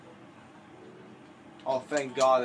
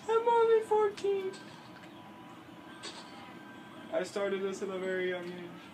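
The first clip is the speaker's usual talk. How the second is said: mock crying; 8 semitones higher than usual. Gamer fakes tears to avoid being sued. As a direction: play the pitiful kid. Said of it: "I'm starting to cry now".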